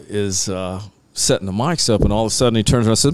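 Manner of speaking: ordinary talk, nothing marked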